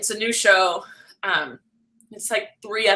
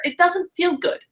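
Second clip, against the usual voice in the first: ordinary delivery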